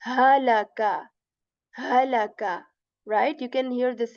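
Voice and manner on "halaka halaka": halaka said with a clear throat H then a. Shown as a demonstration. Teacher reading the example word aloud. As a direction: start halaka crisply from near the chest. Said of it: "we make a sound".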